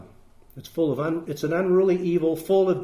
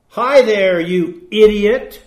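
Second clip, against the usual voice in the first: loud, high, drawn-out insult; vexed disparagement; 4 semitones higher, 11 dB louder, slower than usual. Acted. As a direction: throw it out loudly with vexed contempt. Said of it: "expresses vexed disparagement".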